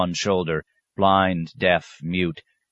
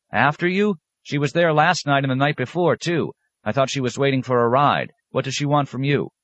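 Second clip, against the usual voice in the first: put-on a touch higher, puzzled young monk; lightly raised young monk questioning the abbot; lightly voiced young monk; 8 semitones higher than usual. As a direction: ask it like a curious younger brother.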